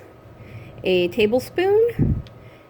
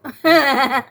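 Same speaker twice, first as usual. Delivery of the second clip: laugh; 5 semitones higher, 7 dB louder than usual. Real, not acted. Laughs at her own pun.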